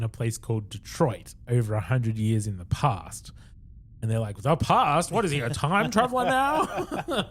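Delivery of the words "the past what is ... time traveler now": high, laughing scoff at the idea; mocking disbelief. Recapping the show, he mocks the aliens with a high laughing scoff. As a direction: sneer it out as if it's absurd, half laughing.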